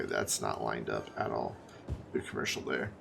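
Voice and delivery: talking through hiccups; hiccuping mid-sentence. Real, not acted. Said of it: "I have the hiccups".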